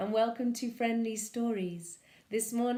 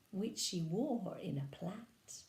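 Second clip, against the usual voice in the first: 1 whisper; 5 dB quieter, slower than usual. Acted. Story line whispered.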